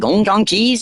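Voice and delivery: Chinese take. Shown as a demonstration. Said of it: "go in a deeper voice".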